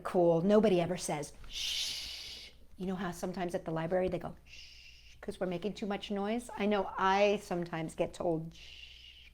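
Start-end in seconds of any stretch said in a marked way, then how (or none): 1.5-2.5 soft hissed shush; quiet library shush. Retold or imitated. 4.4-5.2 soft hissed shush; quiet library shush. Retold or imitated. 8.5-9.3 soft unvoiced whisper; hushed library whisper. Being shushed, unvoiced whisper.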